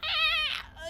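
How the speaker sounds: high squeal while stretching. Real, not acted. Streamer stretching sore legs, high squeal. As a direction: let out a high-pitched stretch noise. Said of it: "let me stretch".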